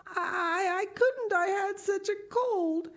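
put-on high, crying girl's voice breaking; tearful girl. A narrator's reading. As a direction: choke it out through tears. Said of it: "Anne dissolved into tears".

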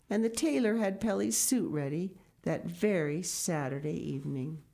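bright, lifted read-aloud storytelling; storybook narration. A narrator's reading. Reading a picture book aloud to children, lifted storytelling. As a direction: tell it like a bedtime story to the kids.

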